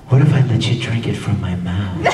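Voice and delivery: low, smooth seductive line; smooth flirty Tamaki. Retold or imitated. Voice actor showing fans Tamaki's low seductive flirting. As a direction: purr it low like a charmer. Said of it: "when he's trying to be all".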